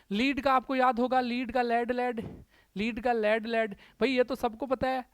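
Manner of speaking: usual speaking voice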